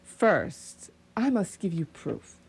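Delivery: ordinary delivery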